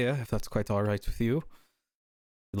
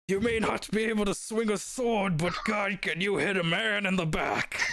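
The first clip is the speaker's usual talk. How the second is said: a much higher voice, ribbing; high teasing NPC; 9 semitones higher than usual. Acted. DM's NPC teasing the woman who smacked him, in a high voice. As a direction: tease her in a high character voice.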